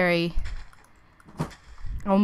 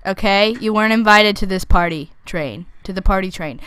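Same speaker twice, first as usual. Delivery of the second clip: normal talking voice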